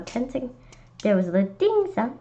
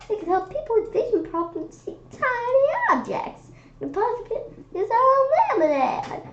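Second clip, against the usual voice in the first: much higher, dreamy voice; dreamy teacher voice; 12 semitones higher than usual. Acted. Miss Yonkers dreaming of helping the world, high voice. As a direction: float it up like a dreamer.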